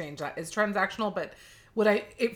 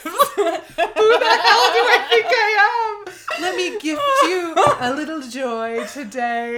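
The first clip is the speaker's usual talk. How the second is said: laughing; 9 semitones higher, 9 dB louder than usual. Acted. Mocking own arrogance, laughing.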